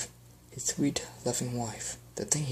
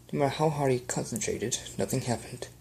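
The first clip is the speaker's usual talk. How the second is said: plain reading voice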